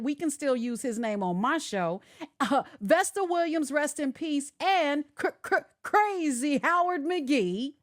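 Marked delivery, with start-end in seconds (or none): none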